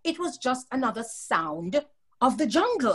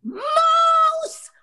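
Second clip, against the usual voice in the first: very high, loud shriek; scream; 7 dB louder than usual. A narrator's reading. Ronald screaming behind Papa, very high shriek. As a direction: shriek right behind him. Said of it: "Ronald would scream behind Papa".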